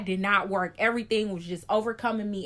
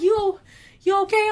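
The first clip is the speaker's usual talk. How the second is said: high, louder worried question; concerned friend; 9 semitones higher, 7 dB louder than usual. Retold or imitated. Friend asks the patient if she's okay, high and alarmed. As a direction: ask with alarm.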